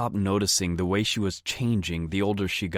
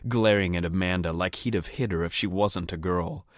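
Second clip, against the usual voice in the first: usual speaking voice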